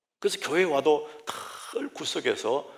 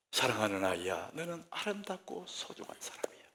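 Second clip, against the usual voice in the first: soft, much quieter loving words; tender blessing; 8 dB quieter than usual. Shown as a demonstration. Preacher models a parent's gentle blessing. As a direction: say it gently to a beloved child. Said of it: "우리 입에".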